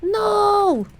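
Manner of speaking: sudden high "No!"; dismayed shout. Real, not acted. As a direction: cry out in dismay. Realizes missing key card.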